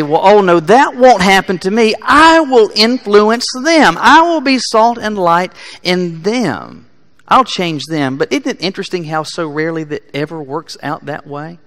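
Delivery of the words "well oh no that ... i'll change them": mock self-assured objector. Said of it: "You may say".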